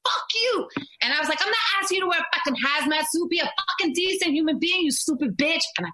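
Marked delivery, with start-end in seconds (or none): 0.0-0.7 high, raised yell; retold yell. Mom shouting back at the woman on the hike, high and raised. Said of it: "Screaming at her". 1.4-5.6 high, fast, furious telling-off; retold rant. Mom ranting at a woman without a mask, high and fast. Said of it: "Screaming at her".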